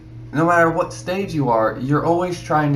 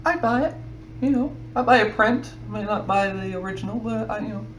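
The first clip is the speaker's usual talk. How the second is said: lifted, higher buyer's voice, casual and friendly; higher, chatty imitation of a customer; mock customer voice; 7 semitones higher, 5 dB quieter than usual. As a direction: play a casual would-be buyer making a suggestion. Voicing a customer's friendly suggestion.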